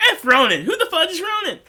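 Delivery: hammed-up squeaky indignation; mock outrage. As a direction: scoff at the villain in playful disbelief.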